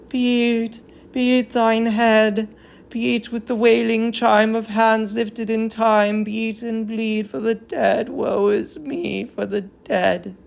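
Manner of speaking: a touch of keening women; mourning chorus. Acted. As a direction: grieve aloud for the prince. Chorus chants ritual beating.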